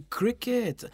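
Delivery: a high, tickled cry of discovery; amused surprise. Real, not acted. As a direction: pounce on the word with glee. Puzzled delight at the word.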